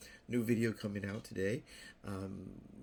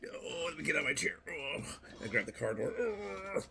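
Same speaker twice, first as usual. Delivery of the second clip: mock struggling groan; 7 semitones higher than usual. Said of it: "I went from".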